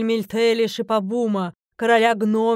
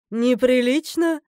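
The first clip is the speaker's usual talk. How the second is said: louder, drawn-out indignant challenge; challenging; 3 semitones higher, 6 dB louder, slower than usual. A narrator's reading. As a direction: throw the word back loudly and slowly. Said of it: "с вызовом спросила богиня". (громко, с вызовом, нараспев)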